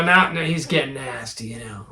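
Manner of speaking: normal talking voice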